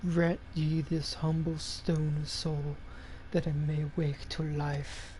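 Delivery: low, hushed, slow chant-like speech; solemn incantation. Acted. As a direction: intone a soft, solemn prayer.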